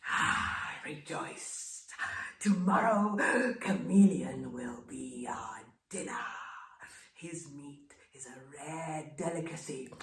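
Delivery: sly whispered crocodile plotting dinner; scheming crocodile whisper turning to a gloating feast line. Acted. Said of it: "whispered to them".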